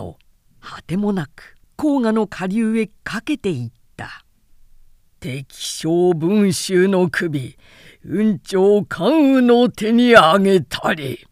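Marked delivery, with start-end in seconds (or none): 5.2-11.3 slow, loud battlefield proclamation; proclaiming victory. Acted. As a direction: call it out across the whole field, drawn out. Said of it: "と呼ばわると".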